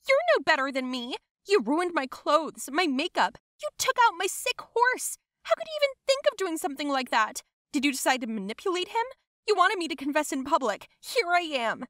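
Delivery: heated, indignant rant; angry accusations. Acted. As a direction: hurl accusations with the pitch way up. Cousin hurls accusations in public fight.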